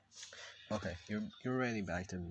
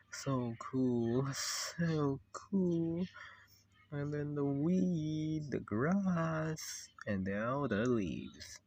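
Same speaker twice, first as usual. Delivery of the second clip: slow, elongated vowels, often higher; dreamy admiration; 3 semitones higher, much slower than usual. Acted. Showing off the drawn jungle, dreamy drawn-out admiration. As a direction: draw each word out in wonder. Drawing scenery, savouring each item.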